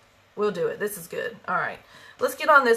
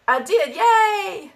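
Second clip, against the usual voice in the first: high, drawn-out happy cheer; excited cheer; 11 semitones higher, 4 dB louder, much slower than usual. Real, not acted. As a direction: celebrate with a laughing yay. Cheers at a viewer's remark.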